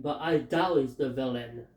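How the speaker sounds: ordinary talk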